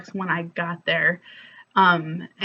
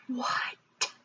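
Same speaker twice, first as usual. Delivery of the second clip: whispered disbelief; 4 semitones higher, 9 dB quieter than usual. Real, not acted. Incredulous aside at herself.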